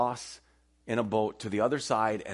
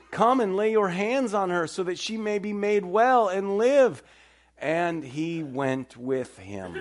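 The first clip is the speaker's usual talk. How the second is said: solemn scripture reading; 6 semitones higher, 3 dB louder than usual. Acted. A preacher reading Jairus's plea aloud to the congregation, solemn.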